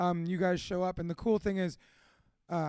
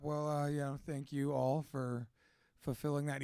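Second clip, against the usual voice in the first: normal talking voice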